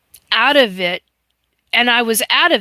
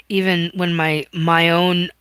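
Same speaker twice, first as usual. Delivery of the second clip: ordinary talk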